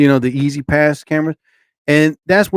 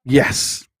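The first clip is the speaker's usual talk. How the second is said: mustache twirling villain. Acted. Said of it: "where is my villain laugh?".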